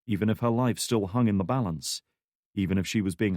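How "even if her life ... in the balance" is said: ordinary delivery, nothing marked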